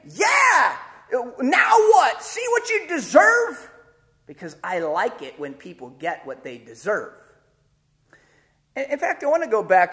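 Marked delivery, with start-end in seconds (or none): 0.1-3.7 much higher, loud taunting; gloating shout. Retold or imitated. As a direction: crow over the pulled-over driver. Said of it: "I was saying".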